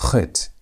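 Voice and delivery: "Chet" begun like a hard H; guttural CH. Shown as a demonstration. Teacher goes from aha to the letter, guttural CH. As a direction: say "Chet" with throat friction at the start. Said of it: "almost like a hard aha".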